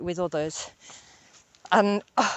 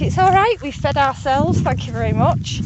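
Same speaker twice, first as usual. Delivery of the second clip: high, louder voicing of smug farm animals; 7 semitones higher, 4 dB louder than usual. Speaker voicing animals who ate the veg, high smug tone. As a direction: play the proud goats pleased with themselves. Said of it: "they always look so proud of themselves".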